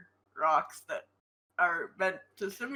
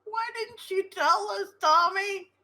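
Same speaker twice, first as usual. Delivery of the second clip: upset shout; 12 semitones higher, 5 dB louder than usual. Acted. Character confronts Tommy.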